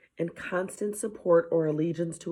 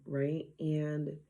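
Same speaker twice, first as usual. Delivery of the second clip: ordinary talk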